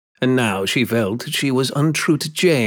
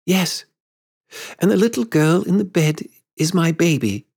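high-voiced woman's insistence; lightly voiced woman, pitched high; grown Wendy; 8 semitones higher than usual. A narrator's reading.

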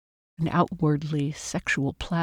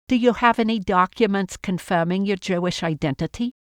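louder contemptuous question; contemptuous barking; 4 semitones higher, 5 dB louder than usual. A narrator's reading. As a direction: bark it with scorn. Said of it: "barked their questions at her with contempt".

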